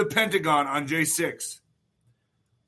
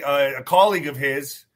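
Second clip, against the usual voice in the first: ordinary delivery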